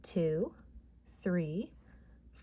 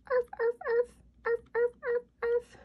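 tiny dog yaps; 3 dB louder, faster than usual. Acted. Dog noises in counting song.

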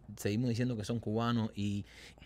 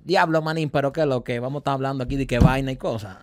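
Dominican accent; 3 semitones higher, 8 dB louder than usual. Shown as a demonstration. Showing his friend how Dominicans talk, Dominican accent. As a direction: rattle it off like a Dominican guy from the street. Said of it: "¿Cómo es el dominicano?".

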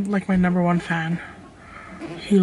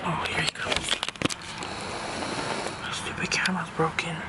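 hushed whisper, as he walks up; secretive camera whisper; 2 semitones lower, 11 dB quieter than usual. Real, not acted. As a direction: drop to a breathy whisper so he won't hear. Hiding from him on camera.

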